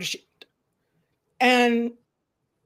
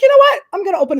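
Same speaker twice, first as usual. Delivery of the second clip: much higher, louder burst of resolve; excited; 9 semitones higher, 10 dB louder, much faster than usual. Retold or imitated. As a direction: blurt it out with sudden resolve. Acted quote of herself deciding.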